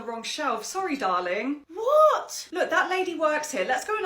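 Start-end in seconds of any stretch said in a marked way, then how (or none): 1.7-2.5 put-on high loud outraged kid; indignant child. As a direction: protest like a cheated child. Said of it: "ten-year-old".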